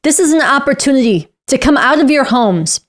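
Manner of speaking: normal talking voice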